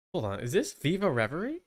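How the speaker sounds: ordinary talk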